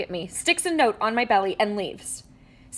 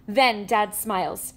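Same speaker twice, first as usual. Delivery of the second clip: ordinary delivery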